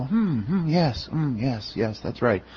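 soft murmured hmms of bored churchgoers; mock drowsy agreement. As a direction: hum along like a dozing congregant. Mocking complacent congregation murmurs.

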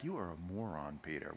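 low, quiet put-down; acted dry exasperation; muttered scorn. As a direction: mutter it under your breath at a foolish friend. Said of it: "the other eleven guys are like".

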